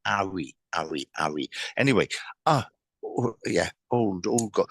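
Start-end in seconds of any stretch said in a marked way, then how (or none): 0.1-1.5 Harry with a silent H, as 'Arry; Cockney-style Harry without the H; dropped H. Shown as a demonstration. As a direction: repeat Harry with no H, starting on the vowel. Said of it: "it's definitely someone that would drop the H's".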